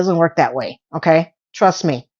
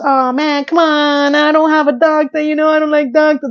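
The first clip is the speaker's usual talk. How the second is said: put-on high, whiny big-baby complaining; whiny crybaby; 6 semitones higher than usual. Mocking the boyfriend's whiny excuse in a high crybaby voice. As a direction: whine it like a grown man acting like a baby. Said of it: "Like a big back crying baby".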